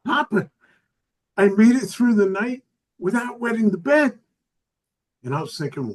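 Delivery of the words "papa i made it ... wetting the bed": put-on high little girl voice, louder; a two-year-old bragging on FaceTime; granddaughter voice. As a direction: squeal it like an excited toddler. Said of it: "She was like".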